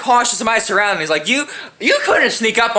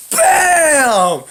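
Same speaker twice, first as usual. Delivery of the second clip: impact shout; 4 semitones higher than usual. Retold or imitated. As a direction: shout the hit like a sound effect. Impact of getting kneed.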